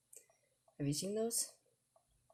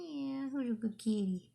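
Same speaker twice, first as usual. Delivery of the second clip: pet talk; 8 semitones higher than usual. Acted. Cooing to a cat.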